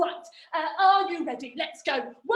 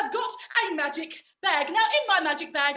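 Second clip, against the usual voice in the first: ordinary delivery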